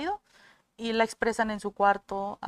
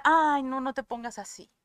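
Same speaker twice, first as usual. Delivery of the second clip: alarmed protest; 3 semitones higher, 6 dB louder than usual. Acted. Listener reacting to a friend starting to cry, alarmed protest. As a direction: cut the crier off with a raised protest.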